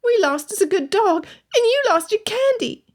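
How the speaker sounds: put-on high boy voice near tears; a little boy about to cry; quivering lip. A narrator's reading. Boy to Elizabeth after losing the dog, tearful. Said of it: "the boy's lower lip quivered".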